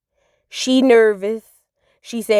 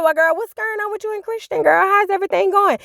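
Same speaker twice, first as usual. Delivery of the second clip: voicing a friend; 10 semitones higher than usual.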